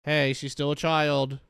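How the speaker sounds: acted indignant call-out; imagined objection. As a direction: speak up as the one sensible relative. Imagined relative objecting to abuse.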